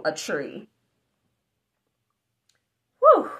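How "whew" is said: ordinary delivery, nothing marked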